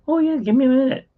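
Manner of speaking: high, quick imitation of a nurse; obliging nurse. Nurse passing by with a blanket request, high hurried imitation.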